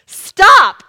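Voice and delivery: one loud, high, sudden command; emphatic shout. Retold or imitated. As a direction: shout it to halt a car. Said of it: "I repeat my emphatic".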